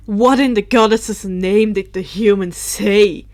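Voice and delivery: loud, steady retake of the outburst; exclaimed. Acted. Drake loudly demands what the human said. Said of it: "Drake exclaimed".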